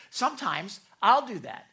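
normal talking voice

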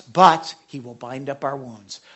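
ordinary delivery

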